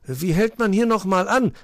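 loud, high-pitched panic; alarmed cry. A narrator's reading. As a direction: call out while hurtling downhill. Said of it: "rief er".

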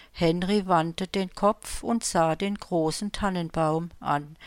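ordinary delivery, nothing marked

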